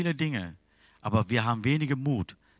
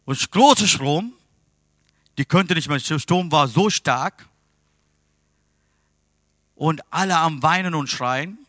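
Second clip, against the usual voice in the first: loud, high-pitched preaching of the storm; loud, lifted sermon storytelling; preaching at full force; 4 semitones higher, 7 dB louder than usual. Real, not acted. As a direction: raise the voice to tell the storm.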